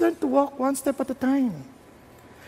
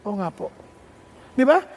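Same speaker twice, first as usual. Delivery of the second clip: ordinary talk, nothing marked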